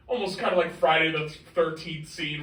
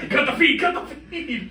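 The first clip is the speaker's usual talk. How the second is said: mock panic; 10 semitones higher, 7 dB louder than usual. Mock alarm at joke.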